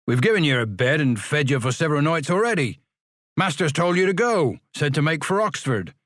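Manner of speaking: a touch of a sneering porter; stern refusal. Acted. Porter Ferriby refusing the boys, mildly scornful.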